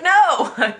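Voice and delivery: emphatic no. Retold or imitated. Wife answering husband's complaint, a loud high no. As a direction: shut the idea down flat out.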